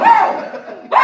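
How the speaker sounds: high whooping cry; hype whoop. Acted. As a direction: whoop it up to rouse the crowd.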